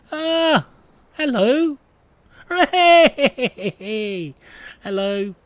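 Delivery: high, loud delighted hello; excited squeal. Real, not acted. Delight when game feature appears.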